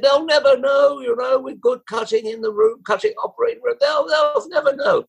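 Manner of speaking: high-pitched mimicking voice; squeaky impression of him; high mock voice. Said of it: "He said".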